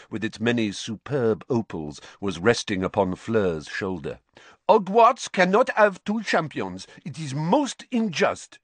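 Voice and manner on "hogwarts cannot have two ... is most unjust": high, raised protest; indignant French headmistress. Acted. Madame Maxime protesting two champions for Hogwarts, indignant. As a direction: object loudly in a lifted voice.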